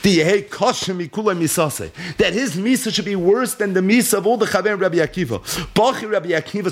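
ordinary talk

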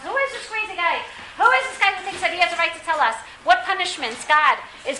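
high-pitched indignant questioning; indignant crowd. Acted. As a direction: act out an outraged listener challenging the speaker.

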